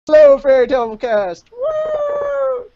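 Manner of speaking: high, loud cheering hello ending in a woo; excited fan greeting. Pretend.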